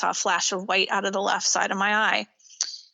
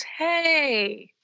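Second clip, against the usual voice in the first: a light, higher-pitched greeting from the son; son's greeting; 7 semitones higher than usual. Retold or imitated. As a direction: toss out a quick, higher hey as the son. Son turns and greets her.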